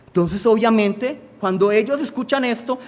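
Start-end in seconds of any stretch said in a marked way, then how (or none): none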